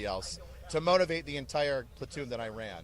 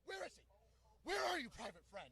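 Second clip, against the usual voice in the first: much higher, softer mock searching call; 11 semitones higher, 13 dB quieter than usual. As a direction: look around for him, playfully. Drill sergeant searching.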